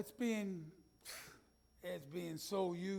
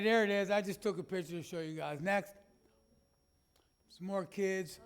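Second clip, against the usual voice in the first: ordinary delivery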